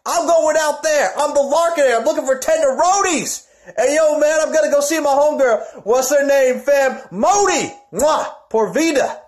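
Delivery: high, loud streetwise swagger; hyped promo persona. Acted. Wrestler swaggering about his homegirl, high and loud. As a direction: strut and call out to the homegirl.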